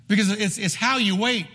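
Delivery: normal talking voice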